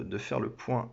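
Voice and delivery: ordinary delivery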